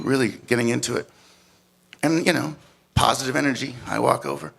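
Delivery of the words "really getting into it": ordinary talk, nothing marked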